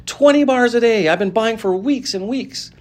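put-on bitter jealous boy; resentful complaint. A narrator's reading. Angry boy continues.

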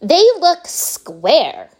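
put-on high, loud, slow spoiled girl; bratty girl. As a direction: whine it like a spoiled brat. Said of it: "said Veruca Salt".

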